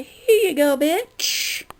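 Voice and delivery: hammed-up sassy dismissal; mock-cheery kiss-off. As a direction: hand it over with a loud, spiteful flourish. Acted kiss-off to inattentive customers.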